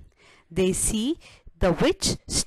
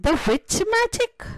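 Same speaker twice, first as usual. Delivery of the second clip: surprised; 13 semitones higher than usual. Acted. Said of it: "Wise men said".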